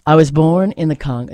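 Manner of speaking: steady, earnest recital with weight on each line; poem reading. Acted. Host reading a Nikki Giovanni poem to listeners, earnest recital. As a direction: read it as a poem you believe in.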